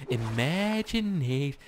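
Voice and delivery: drawn-out kids' cartoon voice; cartoon show host. Player mimicking a kids' cartoon show, drawn-out singsong. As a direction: stretch it slowly like a kids' show. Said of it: "like a fucking cartoon show for kids".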